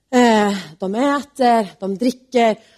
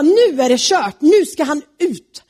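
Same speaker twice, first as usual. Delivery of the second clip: louder and higher, decisive; the king has had enough; loud angry verdict; furious; 5 semitones higher, 4 dB louder, slower than usual. Acted.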